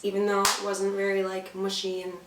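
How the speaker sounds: usual speaking voice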